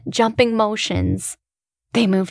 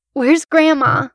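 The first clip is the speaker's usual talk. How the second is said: anxious; 7 semitones higher, 5 dB louder than usual. Acted. Anxious boy asks.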